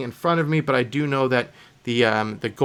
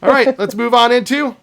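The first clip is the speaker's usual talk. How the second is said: announcer; 9 semitones higher, 8 dB louder than usual. Acted. Announces next segment.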